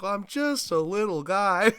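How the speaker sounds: high, slow, timid little Sonic voice, laughing through it; meek little guy voice. Retold or imitated. Sonic answering Am, meek and amused. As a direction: shrug it off like a harmless little guy. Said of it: "he's like".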